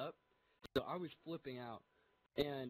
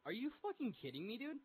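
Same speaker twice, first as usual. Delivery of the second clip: higher-pitched incredulous protest; mock outrage; 8 semitones higher than usual. Patient told surgery must wait, retold indignant disbelief. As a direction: act out disbelief, rising at the end.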